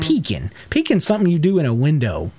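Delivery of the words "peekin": Pecan as PEE-kin; Pecan with a reduced final vowel; hillbilly-ish pronunciation. Retold or imitated. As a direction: say Pecan like peekin'. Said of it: "it sounds so dang hillbilly-ish".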